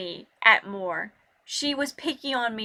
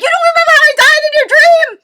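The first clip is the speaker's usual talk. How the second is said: outraged shout; 14 dB louder, faster than usual. Retold or imitated. Outraged at cousin's forgotten dream.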